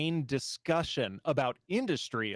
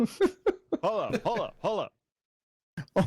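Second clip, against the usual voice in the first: laughing; 8 semitones higher, 4 dB louder, much slower than usual. Real, not acted. Laughing call to stop.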